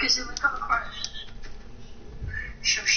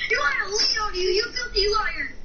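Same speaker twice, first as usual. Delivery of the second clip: furious shout; 10 semitones higher than usual. Acted. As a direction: scream the accusation. Accusing friend in game.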